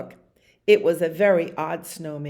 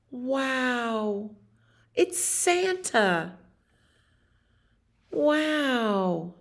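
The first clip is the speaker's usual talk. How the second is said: high, slow wonder; amazed wow; 6 semitones higher, much slower than usual. A narrator's reading. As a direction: gasp it out in awe. Lion amazed at seeing Santa.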